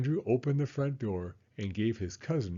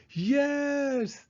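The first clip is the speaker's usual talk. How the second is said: high, sustained cry of yes; happy shout. Acted. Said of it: "they shouted".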